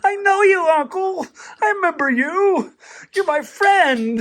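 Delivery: very high, drawn-out mock-crying dog voice; crying happy dog. Puppy recognizing Uncle Matt after two years, mock-crying high voice. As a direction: squeal like an overjoyed dog greeting a long-lost uncle. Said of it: "he was crying".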